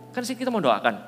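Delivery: ordinary talk, nothing marked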